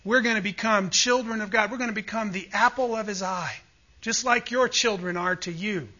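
ordinary delivery

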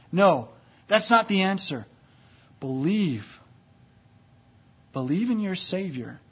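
usual speaking voice

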